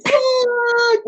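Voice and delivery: very high mock shriek; retold scream. Retelling the bird hitting the van, mock scream. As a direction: shriek the curse in mock panic.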